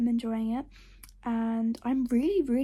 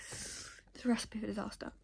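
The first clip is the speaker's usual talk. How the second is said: whisper; 9 dB quieter than usual. Real, not acted. Whispering at bedtime.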